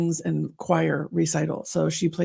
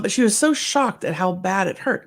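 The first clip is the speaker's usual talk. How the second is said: usual speaking voice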